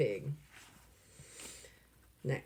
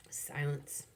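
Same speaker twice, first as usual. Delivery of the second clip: breathy whispered word; 2 semitones lower, 7 dB quieter than usual. A narrator's reading. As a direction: whisper it softly. Whispered narration.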